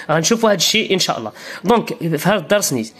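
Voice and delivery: normal talking voice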